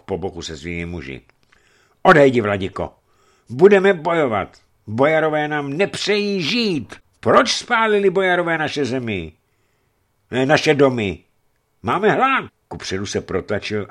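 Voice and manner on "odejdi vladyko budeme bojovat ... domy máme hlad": high, loud pleading cries; women fighting beside their men yell at the bishop; desperate shouting. A narrator's reading. Starving women beg the bishop to leave, desperate shouting.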